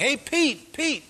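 half-hearted shout after someone; mock shout. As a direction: imitate someone hailing a friend in the street. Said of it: "Somebody keeps shouting".

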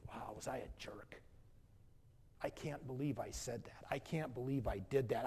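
hushed, guilty self-reproach; ashamed whisper. Acted. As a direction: whisper it like a confession to yourself.

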